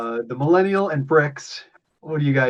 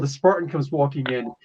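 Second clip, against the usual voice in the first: ordinary talk, nothing marked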